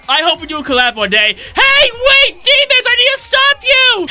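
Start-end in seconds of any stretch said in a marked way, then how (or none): 1.3-4.1 high urgent yell; shouting. Acted. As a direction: call out to stop them. Yelling at fleeing demons.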